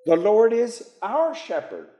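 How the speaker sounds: our high, then falling on shepherd; stress on our. Shown as a demonstration. Teacher offers another possible wording, stress on our. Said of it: "He could say".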